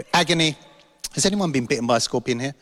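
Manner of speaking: ordinary delivery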